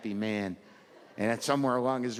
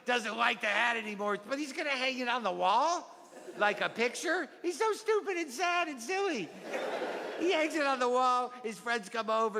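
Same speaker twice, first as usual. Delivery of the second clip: put-on higher-pitched, singsong kid telling a favorite story; eager child reciting storybook; 9 semitones higher than usual. Child shows off the book mom got them, earnest kid voice. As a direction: recount it like an earnest little kid, judging the silly man.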